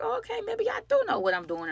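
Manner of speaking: high, giddy self-quote; flattered squeal. Retold or imitated. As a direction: squeak it out, tickled by the compliment. Flattered by photo praise.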